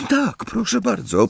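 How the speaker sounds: very high, small squeaky voice; squeak. A narrator's reading. Hermione agreeing to a nickname, high squeak. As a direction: squeak a timid assent. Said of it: "pisnęła Hermiona".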